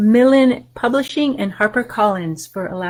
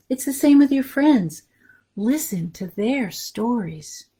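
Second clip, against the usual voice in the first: put-on reading to children. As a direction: read it warmly to little listeners, letting each line land. Reads picture book to children.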